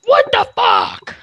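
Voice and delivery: sudden loud shocked cry; startled shout. Real, not acted. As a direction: blurt it out in shock. Startled by sudden death in game.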